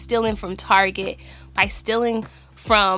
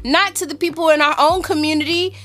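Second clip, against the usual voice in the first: heated, raised angry rant; louder, higher, furious venting; 7 semitones higher, 3 dB louder than usual. Real, not acted. Rant continues after interjection.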